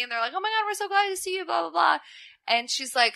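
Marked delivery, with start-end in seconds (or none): 0.3-2.0 high, rushed mock gushing; gushing welcome. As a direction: imitate guys fawning in a high, quick voice. Retold gushing welcome from the guys.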